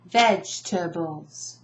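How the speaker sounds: "Vegetables" slowly as VEJ-tuh-bulls; "Vegetables" in three beats, first one strongest; stress on first syllable. Shown as a demonstration. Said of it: "Where's the emphasis?".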